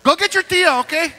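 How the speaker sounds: high, loud hushing parent; Mexican parent voice. Retold or imitated. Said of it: "my kids are Mexican".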